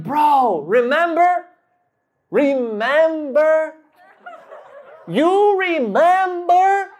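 high, stretched-out prompting, mounting each time; pointed hinting. Retold or imitated. Nudging his buddy into the cover story, high insistent hinting. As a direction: prod a clueless friend to play along, exaggeratedly. Hinting hard at a made-up plan.

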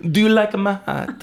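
light fake Italian accent between friends. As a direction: toss off the Italian line as a private joke. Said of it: "Nick and I will say to each other".

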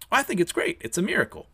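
high-pitched acted enthusiasm; delighted admiration. Kusanagi answers Dora about the samurai's offer, delighted. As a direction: gush as an admiring sidekick. Kusanagi thinks she's cool.

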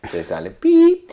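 mock nose grow sound. Pinocchio nose growing sound.

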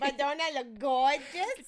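put-on slow, loud kid-speak, "gorgeous" with a mangled r; mocking little-kid pronunciation of "gorgeous"; mimicked childhood impediment. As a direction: tease with a drawn-out childish "gorgeous". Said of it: "how you used to have to talk".

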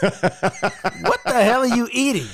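asked through real laughter; laughing in disbelief at the chewing; laughing question. Real, not acted. Cohost laughs at his friend eating on tape.

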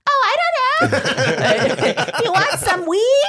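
very high, airy ditzy voice; dumb girl act. Retold or imitated. Teen playing dumb to the dealer, high and ditzy. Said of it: "to just kinda be like".

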